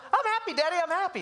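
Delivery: put-on high, sing-song little kid's voice; dad imitating his little kid chirping back; child's voice. Dad imitating his toddler declaring she's happy, high sing-song.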